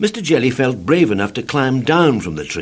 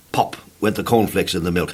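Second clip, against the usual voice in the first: ordinary delivery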